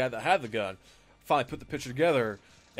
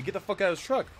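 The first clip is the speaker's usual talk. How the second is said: mild mock yell, pitched up; imitated yelling; 8 semitones higher, faster than usual. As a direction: re-enact the owner's angry order. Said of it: "he's just yelling at me".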